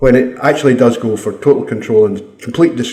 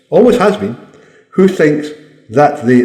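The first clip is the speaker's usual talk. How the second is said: ordinary talk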